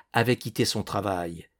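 ordinary delivery, nothing marked

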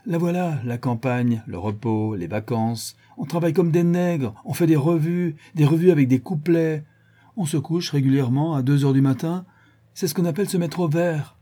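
mock-sorry complaint, high and building; aggrieved lament. A narrator's reading. Jean bemoans his working holiday, aggrieved and high. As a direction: list the woes with rising indignation. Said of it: "prit un air navré en disant".

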